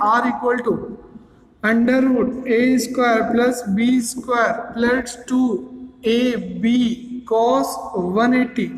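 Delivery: very slow, word by word with pauses; slow dictation. Shown as a demonstration. Teacher writing formula for class, very slow dictation. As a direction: spell it out as you write each term.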